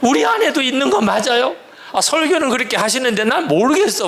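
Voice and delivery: high-pitched mock yell at the pastor; strained, high demand, playfully imitated; imagined congregants' outcry. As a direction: act out church members protesting, pitch way up. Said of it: "이렇게 소리 지르겠구나".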